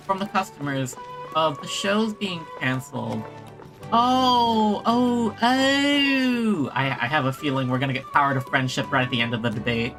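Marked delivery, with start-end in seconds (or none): none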